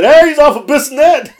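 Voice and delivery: high, loud shout breaking into laughter; laughing mock announcement; gleeful callout. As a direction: blurt it out as a teasing heckle. He and rider blurt it, laughing.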